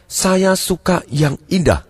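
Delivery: put-on slow, lingering delivery; dreamy customer act.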